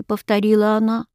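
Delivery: ordinary delivery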